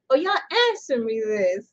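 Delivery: ordinary talk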